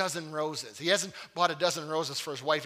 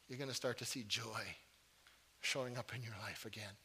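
softer, low-key sermon appeal; softer, close-up preaching voice; intimate quiet preaching; 5 semitones lower, 5 dB quieter than usual. Acted. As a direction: draw them in with a hushed earnest plea. Quiet, intimate closing exhortation.

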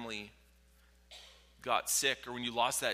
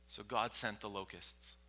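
ordinary talk

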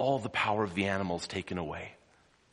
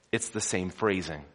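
ordinary talk, nothing marked

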